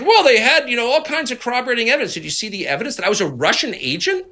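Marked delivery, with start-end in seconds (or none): 0.0-2.1 mocking imitation. Mocking the congressman's excuse for trusting briefers. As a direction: parrot him back as a feeble excuse. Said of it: "And he's like".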